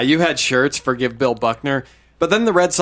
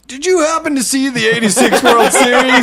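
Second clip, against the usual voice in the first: high-pitched, laughing pretend question, raised throughout; mock leading question; 9 semitones higher, 6 dB louder than usual. Friend imagines ballplayer quizzing young fan, mock-eager and high. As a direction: play the player fishing, half laughing. Joking act of touchy player.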